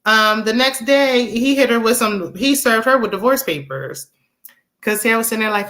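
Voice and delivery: usual speaking voice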